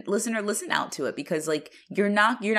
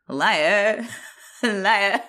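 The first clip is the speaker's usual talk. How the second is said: imitated accent, LIAR with the final r dropped; odd accent on liar; 3 dB louder than usual. Co-host mimics how Craig says it, odd accent. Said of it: "He says, like".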